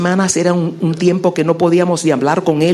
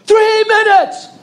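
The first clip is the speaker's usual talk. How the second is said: very high, slow barked call; drill sergeant shout; 14 semitones higher, 3 dB louder, slower than usual. Retold or imitated. Boot camp instructor cutting in, shouting slowly. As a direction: bark the time limit like a drill instructor. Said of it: "este muchacho grita".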